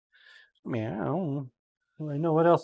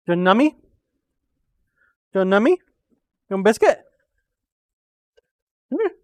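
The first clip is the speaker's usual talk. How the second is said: louder coaxing pet voice; talking to a dog; 3 semitones higher, 5 dB louder, much faster than usual. Real, not acted. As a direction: coax the dog with a treat. Offering the dog a treat.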